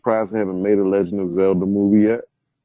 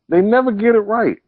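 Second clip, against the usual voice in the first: high exasperated stress on never; 11 semitones higher than usual. Real, not acted. Friends complaining about game movies, exasperated stress on never. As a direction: lean hard on never in frustration.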